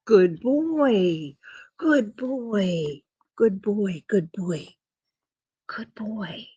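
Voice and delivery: drawn-out cooing praise; praising the dog. Real, not acted. Owner praising the dog for sitting, cooing. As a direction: coo it like rewarding a pet.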